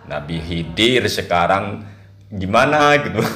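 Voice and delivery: ordinary talk, nothing marked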